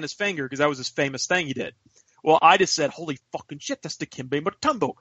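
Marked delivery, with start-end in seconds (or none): 2.9-4.9 much softer, amazed aside; retold hushed disbelief; hushed starstruck awe. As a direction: say it under your voice, astonished. Retells his starstruck reaction again.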